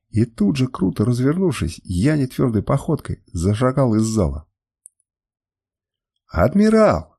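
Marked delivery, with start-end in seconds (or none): 6.3-7.2 high, louder call of "Admiral"; distant crowd cry. A narrator's reading. Followers cry after their departing admiral, calling out. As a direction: call out after him, voice raised. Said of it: "доносились до меня чьи-то крики".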